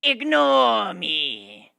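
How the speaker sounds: very slow, loud, drawn-out villain voice; abridged Merrick impression. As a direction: ham up a cartoon villain's command. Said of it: "in my best imitation of a Yu-Gi-Oh's abridged Merrick".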